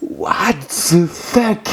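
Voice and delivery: very high, slow drawn-out cry of disbelief; floored by the twist; stunned disbelief. Real, not acted. Reacting to the fruit's origin, stunned.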